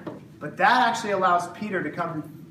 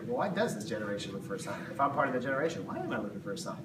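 much softer and lower, quick private musing; inner thought; 7 semitones lower, 8 dB quieter, faster than usual. Retold or imitated. Preacher voices a listener's private thought, hushed and low. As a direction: mutter it to yourself as if thinking it through. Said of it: "you gotta stop and you gotta think".

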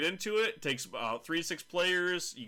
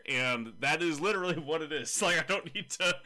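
ordinary delivery

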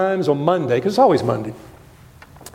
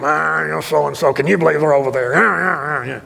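mock gossip; 5 semitones lower than usual. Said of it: "you can hear 'em".

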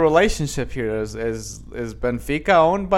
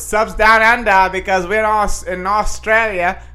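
loud, higher, hammed-up Australian; horrible Australian accent; 5 semitones higher, 7 dB louder than usual. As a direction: do a deliberately bad Australian accent, loudly. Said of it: "with my horrible accent".

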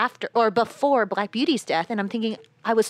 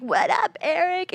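bro greeting; 10 semitones higher, slower than usual. Retold or imitated. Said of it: "someone comes up and like".

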